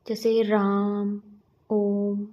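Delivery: slow राम and ओम with the vowels held; prolonged vowels. Shown as a demonstration. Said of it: "इसी को ही प्लुत स्वर कहा जाता है". (राम और ओम धीरे, स्वर लंबा खींचकर)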